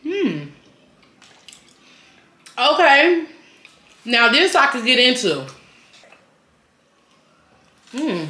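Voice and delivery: eating between words; talking while chewing. Real, not acted. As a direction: mouth partly full. Seen 2: Right speaker talks between bites, holding burger, jaw working.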